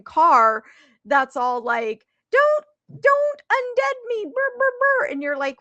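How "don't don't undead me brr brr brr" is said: robot car voice. Retold or imitated.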